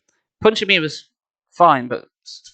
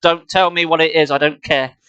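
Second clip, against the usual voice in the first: usual speaking voice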